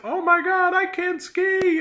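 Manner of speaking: high, loud mock panic; panicked cry. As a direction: squeal it like a skier losing control. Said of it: "And that's when I realize".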